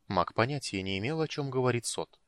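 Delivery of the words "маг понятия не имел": ordinary delivery, nothing marked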